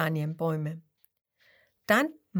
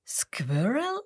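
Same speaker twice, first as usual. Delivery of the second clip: puzzled; 4 semitones higher than usual. Acted. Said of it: "fragt Grenouille".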